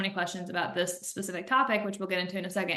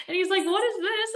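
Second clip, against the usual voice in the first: high, laughing mock question; laughing quote; 12 semitones higher, 4 dB louder than usual. Quoting her husband's confused question while laughing. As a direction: squeak it out through laughter.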